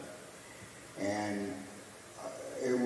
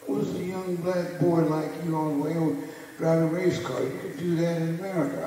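teasing Ali voice, pitched up; Ali impression; 9 semitones higher than usual. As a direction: talk down to him like the champ.

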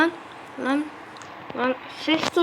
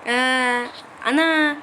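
ordinary talk, nothing marked

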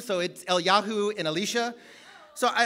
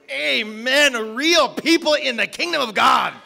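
loud preacher's cheer, building; rousing amen; 6 dB louder than usual. Retold or imitated. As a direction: belt it out to the congregation. Preacher cheering an honest answer.

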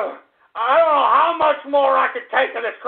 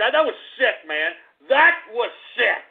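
normal talking voice

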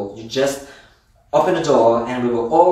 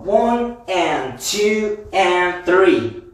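counting the beat; 6 semitones higher, much slower than usual. Shown as a demonstration. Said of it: "Let's do it with the count".

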